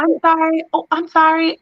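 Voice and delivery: high, light little sorry; imitating daughter's apology. Mother imitating her daughter apologizing. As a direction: voice the toddler sweetly. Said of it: "She says".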